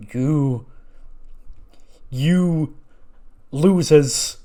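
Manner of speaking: contemptuous yell. Retold or imitated. Jericho rants at the quitters.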